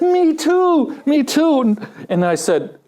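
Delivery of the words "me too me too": high, eager agreement; eager me too. Retold or imitated. Preacher telling a joke, eagerly agreeing with the jumper. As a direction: chime in eagerly, delighted.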